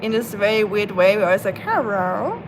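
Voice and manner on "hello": "Hello." said as "Herro", the L turned into an R; silly pet voice saying "Hello." with an R for the L; playful "Hello." with R instead of L; mock Oscar voice. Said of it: "we act like Oscar can't say L".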